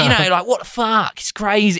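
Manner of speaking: ape a far-out nineties [name] singer. Retold or imitated. Host imitating the sculptor in a [name]-style voice. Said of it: "He sounds a bit like Superhands from Peep Show".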